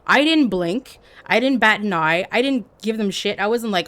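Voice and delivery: normal talking voice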